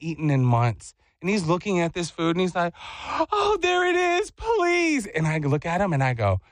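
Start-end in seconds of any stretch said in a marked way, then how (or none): 3.3-5.1 high-pitched pleading kid imitation; little sad boy. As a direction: beg like a starving child at the glass. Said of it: "like he is some little sad boy".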